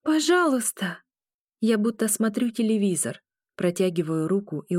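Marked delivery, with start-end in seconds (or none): 0.0-1.0 pleading cry. A narrator's reading. Pleading in a vision.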